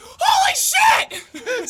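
very high, slow, drawn-out shriek; panicked shriek. Retold or imitated. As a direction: squeal in shock as the parents show up. Parents arrive, acted panic.

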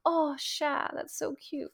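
high, soft coo; acted melting fondness, high and hushed; cute-puppy reaction. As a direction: coo softly over a puppy. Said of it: "if you showed me a cute puppy".

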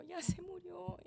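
high, distressed announcement; retold grief. Widow tells her brother of the death, high grieving voice. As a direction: let the news out as a high wail.

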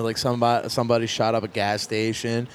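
normal talking voice

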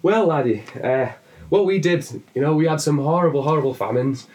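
put-on high-pitched Irish accent that drifts toward Welsh; a cheery, hammed-up Irish brogue with a lilt. Joking to the Englishman, in a put-on Irish accent. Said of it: "if I can do an Irish accent".

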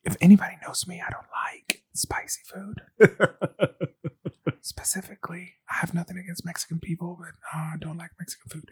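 hushed whisper; whispered confession. Real, not acted. Teller whispers her dislike of Mexican food as an aside. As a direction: whisper it like a guilty secret.

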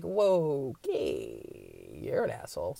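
mock-stunned reply; deadpan rebuke. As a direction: drop low and call them out. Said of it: "And it's just like".

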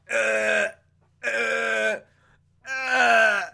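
strained rising grunts, building to a cry; mock straining uh uh uh. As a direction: grunt like you're struggling helplessly. Prisoner straining, can't undo pants.

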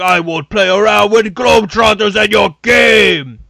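loud, slow, put-on villain voice declaring terms; booming villain challenge. Big player challenging the Globetrotters, slow booming villain shout. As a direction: boom it out like a wrestling heel laying down the rules. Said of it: "he's like".

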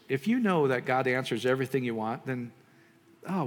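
normal talking voice

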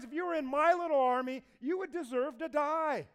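mock the lousy guards to their faces; sarcastic taunt. David mocks Abner for failing to guard the king, loud sarcastic jeer. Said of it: "one of the greatest pieces of sarcasm".

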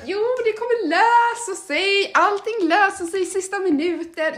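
mock-calm it will all work out; breezy reassurance. Lugnar vännen, spelad. (högre, långsam lugnande ton)

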